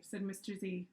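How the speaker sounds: usual speaking voice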